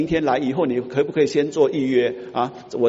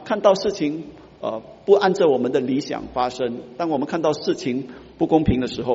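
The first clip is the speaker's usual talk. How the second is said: normal talking voice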